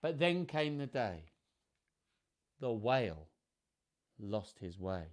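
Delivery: measured, lilting picture-book reading; children's story narration. A narrator's reading. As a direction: read it to children, savouring the rhymes. Reads picture book aloud.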